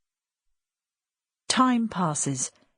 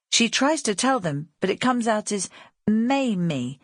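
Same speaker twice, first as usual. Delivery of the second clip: plain reading voice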